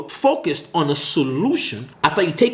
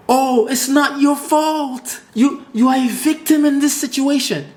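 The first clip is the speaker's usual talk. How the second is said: mock pitying voice; 9 semitones higher than usual. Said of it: "somebody tells you".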